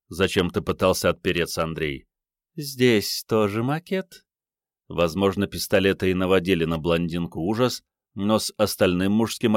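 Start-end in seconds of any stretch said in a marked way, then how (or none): 2.6-4.2 slow, high, rising coy question; coy feminine voice lifting at the end; teasing blonde. A narrator's reading. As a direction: draw the question out flirtatiously. Blonde teases the man.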